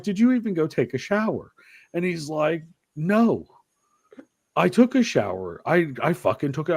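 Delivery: high, mock-kid back-and-forth; arguing with son. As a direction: act out the shower spat, pitch up.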